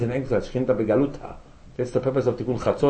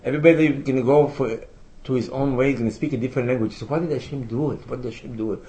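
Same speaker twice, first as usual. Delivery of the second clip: ordinary delivery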